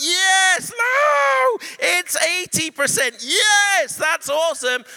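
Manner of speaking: high, loud joyful shouting; excited shout. Retold or imitated. Preacher shouts at the eighty percent news, loud and high. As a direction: shout with delight. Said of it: "And I'm going".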